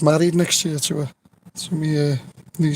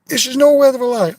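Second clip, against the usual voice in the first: excited insistence; 8 semitones higher, 4 dB louder than usual. Real, not acted. Emphatic claim in anecdote.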